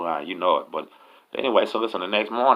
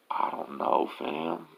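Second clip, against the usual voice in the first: soft, halting and unsure; quiet acted shrug of a reply; hesitant retold reply; 9 semitones higher, 7 dB quieter, much slower than usual. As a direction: act out a hushed, reluctant answer. First inmate hesitates when asked.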